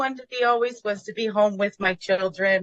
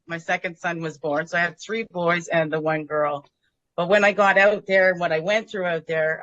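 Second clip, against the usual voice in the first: ordinary talk, nothing marked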